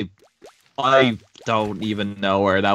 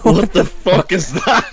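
laughing; 8 semitones higher, 6 dB louder than usual. Real, not acted. Laughing at friend's bad accent.

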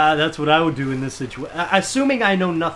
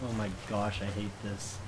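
scared mutter; 9 semitones lower, 14 dB quieter, faster than usual. Real, not acted.

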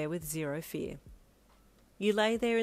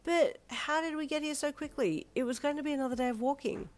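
high, puzzled disbelief; astonished, pitched-up questioning; amazed; 9 semitones higher than usual. Acted. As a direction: ask it in wonder. Astonished to be home already.

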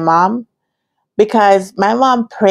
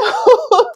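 quick laugh. Real, not acted. Laugh mid-story.

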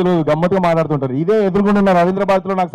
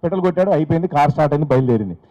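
ordinary talk, nothing marked

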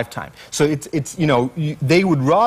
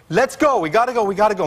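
much higher, rising in panic; panicky higher voice; 7 semitones higher, faster than usual. Retold or imitated. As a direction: pitch climbing with alarm as the bear pushes the truck. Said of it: "My voice is going higher and higher".

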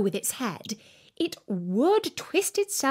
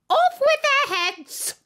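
very high, loud, slow decree; queen's death sentence; 5 dB louder, slower than usual. A narrator's reading. Queen condemning the gardeners, loud decree. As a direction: bellow the verdict.

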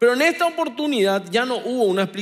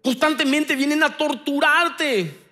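raised, slowed sermon with punched words; full-force preaching; 6 semitones higher, 4 dB louder than usual. Acted. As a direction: preach it with fire to the congregation.

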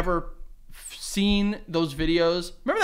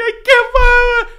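mock crying kid; 13 dB louder than usual. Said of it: "broke down crying".

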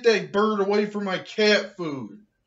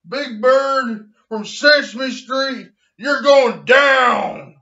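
slow, loud shout; mock threat; 4 semitones higher, 6 dB louder than usual. Threatening Big Bird, slow shouted warning. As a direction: hurl the threat slowly.

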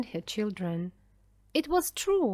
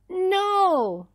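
high, loud cry of despair; horrified cry; 9 semitones higher, 5 dB louder than usual. A narrator's reading. Daniel seeing the boat go down, crying out. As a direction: cry out in horror. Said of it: "Daniel cried".

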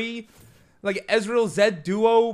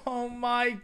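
ordinary talk

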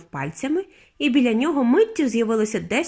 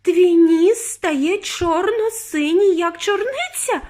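high, slow wail of alarm; horrified yelling; 8 semitones higher, 4 dB louder, much slower than usual. A narrator's reading. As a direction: stretch each word in shrieking horror. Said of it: "репетувала пані Борогар".

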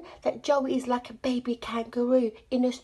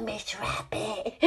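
usual speaking voice

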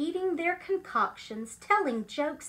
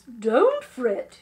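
loud, confident encouragement; hearty teacher's reassurance; firm reassurance; 3 semitones lower, 9 dB louder than usual. Acted. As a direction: buck the class up. Teacher reassures the worried class.

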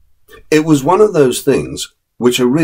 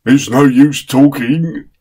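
higher, slow, amused servant's voice; grinning chauffeur; 5 semitones higher, 3 dB louder, slower than usual. Acted. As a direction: give in with a grin. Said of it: "said the chauffeur, grinning".

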